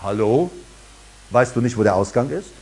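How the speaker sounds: a touch of sarcasm; mock dismissive. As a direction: show fear the door with calm scorn. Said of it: "sagst du".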